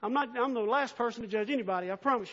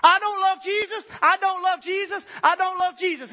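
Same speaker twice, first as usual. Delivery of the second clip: mock scream, much higher and louder; 9 semitones higher, 11 dB louder than usual. Preacher voicing a sinner's life, screamed loud. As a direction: yell it like your life shouts it. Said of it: "your life is screaming it".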